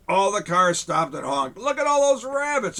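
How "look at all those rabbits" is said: high, loud excited shout; a crowd shouting at the rabbits. Acted. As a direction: call it out in amazement. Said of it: "everybody shouted".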